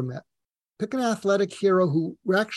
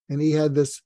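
ordinary talk

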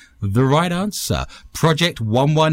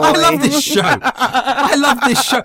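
laughing; 12 semitones higher, 3 dB louder, slower than usual. Real, not acted. Laughing at the joke.

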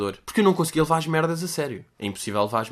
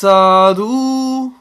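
drawn-out sarcasm; 9 semitones higher, 10 dB louder, much slower than usual. Pretend. Drawn-out mock remark.

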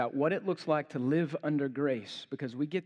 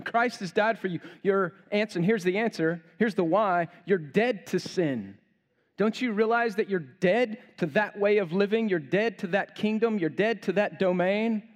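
preaching at full force; 4 semitones higher, 4 dB louder than usual. Acted.

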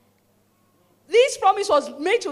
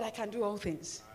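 softer, lower muttered reminder; quiet self-talk; 6 semitones lower, 10 dB quieter than usual. Retold or imitated. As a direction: quietly encourage yourself.

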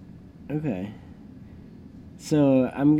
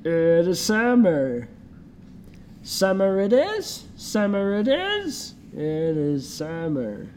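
slow slurpy slug voice; Slug from Monsters Inc; 6 semitones higher, slower than usual. Acted. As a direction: drawl it out like a lazy slug. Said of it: "you sound like the Slug from Monsters, Inc".

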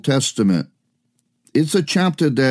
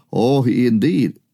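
ordinary talk, nothing marked

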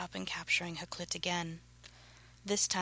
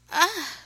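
high, loud breathy cry; sudden sharp cry of pleasure; 11 semitones higher, 9 dB louder than usual. A narrator's reading. Woman reacting to her lover, crying out. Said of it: "she cried out".

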